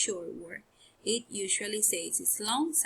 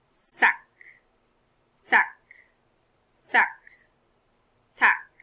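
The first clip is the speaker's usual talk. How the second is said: clear, loud repeated drill words; drill words for class; 5 dB louder, slower than usual. Shown as a demonstration. Teacher drilling pupils on the short ck words. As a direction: call each word out for the class to repeat. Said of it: "so we have".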